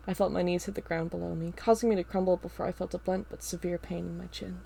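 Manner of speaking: ordinary delivery, nothing marked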